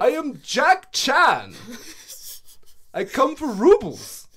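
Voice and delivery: a deliberately bad Russian accent, pushed up and loud; hammed-up Russian accent sliding into something else; botched Russian accent. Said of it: "It started Russian".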